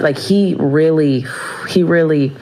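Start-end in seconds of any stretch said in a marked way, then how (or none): none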